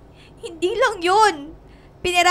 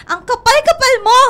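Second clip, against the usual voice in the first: loud, higher angry snap; angry outburst; 5 semitones higher, 5 dB louder than usual. Acted. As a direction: explode at her betrayal. Confrontation, betrayed friend. (galit na pasigaw)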